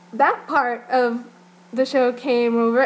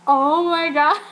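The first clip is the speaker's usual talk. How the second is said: a louder, laughing oh my God; laughing disbelief; 5 semitones higher, 5 dB louder, slower than usual. Retold or imitated. The fans reacting to the dancers' joke, laughing disbelief. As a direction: gasp it out through laughter.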